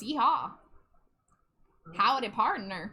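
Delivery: playful Southern cowboy twang. Pretend. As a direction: greet like a put-on Southern cowboy. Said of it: "it's Southern voice".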